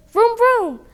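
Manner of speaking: high loud vroom, then slow loud rallying cry; engine noises and call. Acted. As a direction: rev up the scooter and charge off. Sister starts her scooter.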